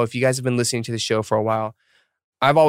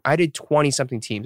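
ordinary talk

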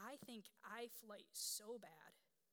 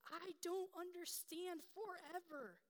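put-on small scared child; 9 semitones higher, 5 dB louder, slower than usual. Quoting daughter's fear.

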